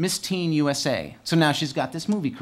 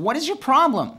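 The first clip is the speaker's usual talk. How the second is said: much higher, a bit louder challenge; indignant retort; 10 semitones higher, 4 dB louder than usual. Retold or imitated. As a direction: fire back at the rude riders. Yelling back at riders.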